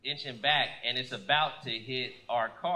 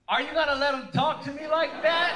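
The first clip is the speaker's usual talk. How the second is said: high-pitched imitated outrage; mock indignant wife voice; 11 semitones higher, 3 dB louder than usual. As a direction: demand backup, scandalized. Outraged wife confronts her husband.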